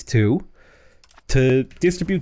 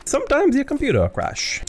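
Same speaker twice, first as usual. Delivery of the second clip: hammed-up Italian accent, the pitch jumping much higher; mock Italian accent. Gamer mocking his old computer in a high fake Italian accent. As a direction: squeal the line in a clownish Italian accent. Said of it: "I became Italian for this bit".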